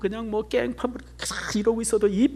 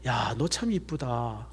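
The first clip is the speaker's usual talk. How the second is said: slow, soft compliment to the pregnant woman; tender praise; 8 semitones lower, 9 dB quieter, much slower than usual. Retold or imitated. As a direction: praise her gently and slowly. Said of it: "항상 칭찬해줬어요". (임신부에게 천천히 다정하게 칭찬하듯)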